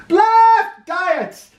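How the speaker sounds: high loud outburst; indignant shout. Acted. President rejecting diets, loud and high. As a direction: explode at the idea. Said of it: "said Taft".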